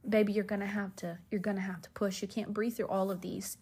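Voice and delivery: hushed, even reassurance; imitated gentle whisper. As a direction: murmur it patiently, as if close by. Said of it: "came and whispered to me".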